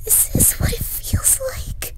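high, frightened whisper. Acted. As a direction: whisper it in disbelief. Hiding in closet from intruder.

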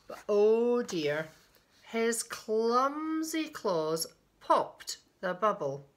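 drawn-out, emphatic storybook reading; slow, dramatic storyteller; story narration. A narrator's reading. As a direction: stretch the key words for small listeners. Reads a picture book aloud.

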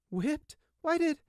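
high, shocked and cut off; horrified little brother; shocked question. Acted. Gossamer voiced, shocked.

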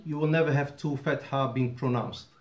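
normal talking voice

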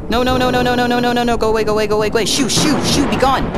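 high, loud desperate no-nos; panicked pleading. Real, not acted. Terrified player begging the monster to leave, loud panic. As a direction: beg it to go away in full panic.

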